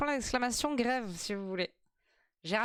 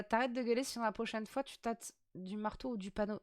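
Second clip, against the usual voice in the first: normal talking voice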